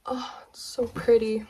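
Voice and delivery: awed whisper; hushed awe at the details. Real, not acted. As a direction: breathe it in a hush. Awed over skirt.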